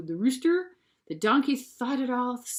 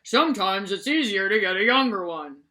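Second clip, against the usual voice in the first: a hint of a farmer; 5 dB louder than usual. A narrator's reading. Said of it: "the one farmer said".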